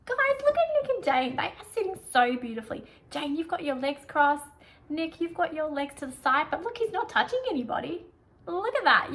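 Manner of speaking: high, loud, gushing praise; over the top praise. Shown as a demonstration. Prep teacher loudly praising kids sitting beautifully. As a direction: ham up the praise for the little ones. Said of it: "you can really go over the top".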